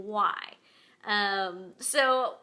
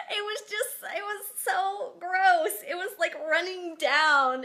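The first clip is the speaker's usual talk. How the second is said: laughing; 6 semitones higher, 4 dB louder than usual. Real, not acted. Laughing retelling bird poop.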